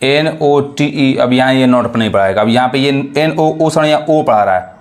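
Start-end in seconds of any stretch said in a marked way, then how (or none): 0.0-1.1 N O T E spelled out slowly; teacher spelling for the class. Shown as a demonstration. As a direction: read the letters one by one. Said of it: "ये क्या पढ़ रहा है".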